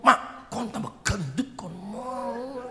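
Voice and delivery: high, drawn-out teasing remark; tactless husband. Acted. Husband tells wife she got fatter, high and drawn-out. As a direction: exclaim it high and slow. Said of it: "Jangan dia ngomong".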